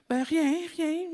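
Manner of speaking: put-on a kid insisting nothing happened, drawn out; small voice saying nothing at all; child's denial. Child denying to her father.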